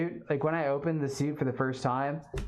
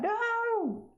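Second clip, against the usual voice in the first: shocked squeal. Real, not acted. Hearing the viewer broke both phones.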